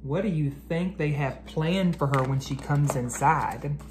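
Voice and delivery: ordinary delivery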